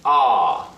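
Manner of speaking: "ah" starting high and dropping; falling "ah"; fourth tone. Shown as a demonstration. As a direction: let "ah" fall from high. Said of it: "start your pitch a little bit higher and then let it drop".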